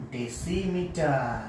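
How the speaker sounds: 2 drawn out very slowly for pupils to repeat; 3 say kilometre syllable by syllable, very slowly; 1 slow dictation. Shown as a demonstration. 4 teacher reading kilometre aloud for pupils to copy, very slow. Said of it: "উচ্চারণ করে শোনাবো".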